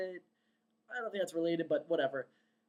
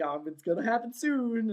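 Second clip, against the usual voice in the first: higher, louder cartoon president voice; goofy, excited; 6 semitones higher, 5 dB louder than usual. Cartoon president announcing his visit through the window, goofy and excited. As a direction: bubble over with dopey excitement. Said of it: "he's all goofy and excited".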